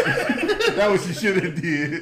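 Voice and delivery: laughing. Real, not acted. Laughing joke about dog eating.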